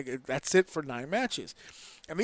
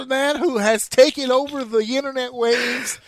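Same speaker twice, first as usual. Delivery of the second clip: big booming mock announcer voice; hype announcer; 8 semitones higher, 9 dB louder than usual. Podcast host hyping up Mister Iguana, booming announcer. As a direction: announce him like a ring introduction.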